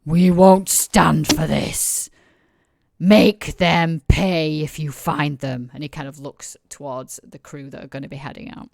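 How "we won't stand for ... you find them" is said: slow, loud, growled threat; a furious leader laying down the law; roaring tyrant king. Acted. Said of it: "he slams his fist hard on the table".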